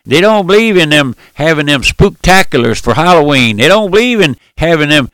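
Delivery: high, loud mimicry of scandalized gossips; mocking gossips. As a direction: imitate neighbours warning others off a strange church. Voicing townsfolk who mock his church.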